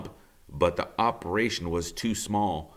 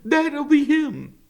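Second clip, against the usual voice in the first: knowing beaver; 7 dB louder, slower than usual. Acted. Beaver recognizing the signal.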